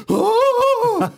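very high, drawn-out scream; high held "ah" of terror, played for laughs; mock scream. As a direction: imitate a horror victim's shriek. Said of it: "she's like".

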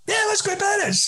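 high, eager mock agreement; eager group yes. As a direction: squeak a keen let's go.

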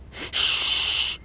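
long unvoiced hush; urgent shushing. Startled dog hushing tortoise at the farm, urgent shush. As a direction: hush someone who may give you away.

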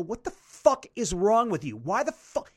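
slow, high, loud berating; angry outburst. Retold or imitated. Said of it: "I said it like that".

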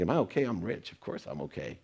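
hushed, half-voiced private thought, fading to a breath; scoffing under the breath at the doctor's question; inner scoff. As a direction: mutter it to yourself smugly. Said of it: "I'm thinking".

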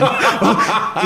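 high burst of laughter. Real, not acted. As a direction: laugh out loud. Laughs at own story.

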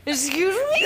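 high, hammed-up affronted question; indignant disbelief. Woman reenacting her reply to rude man, mock indignant. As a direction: throw it back in mock outrage. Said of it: "Ahora sí que".

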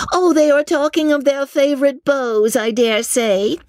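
very high, squeaky busybody voice; Miss Steele, gossipy. Acted.

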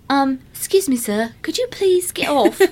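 high, prim voice asking the ghost politely; mock polite plea. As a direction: ask the ghost sweetly to get off.

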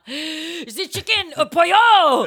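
high, loud comic yell; mock shout. Host hams up chasing a live chicken, loud comic yell.

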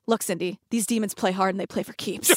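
low, quiet deadpan imitation; straight-faced. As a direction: quote him gravely, low and soft, as if deadly serious. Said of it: "said that with a straight face".

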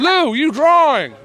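high, loud, drawn-out Philly imitation; Philly accent. Shown as a demonstration. Calling Lou with borrowed slang, mock Philly accent. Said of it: "I should do some of your Philly accent".

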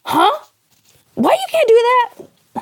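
high, drawn-out mock protest; put-on baffled objection, slow and high; imitating baffled disbelief. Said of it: "it's his same reaction".

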